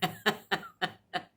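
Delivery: short amused laugh; amused giggle. Real, not acted. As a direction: giggle at the gossip. Laughing about neighbor.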